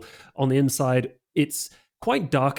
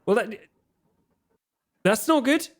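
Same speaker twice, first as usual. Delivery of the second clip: character voice; 9 semitones higher, faster than usual. Acted. GM voices Bruce, high.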